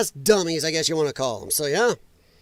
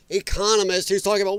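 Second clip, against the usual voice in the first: normal talking voice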